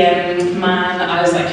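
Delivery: usual speaking voice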